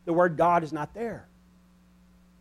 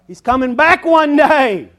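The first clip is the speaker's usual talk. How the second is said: high, loud and slow; ringing proclamation; 10 semitones higher, 15 dB louder, slower than usual. Acted. Preacher promising the congregation Jesus returns, loud and slow.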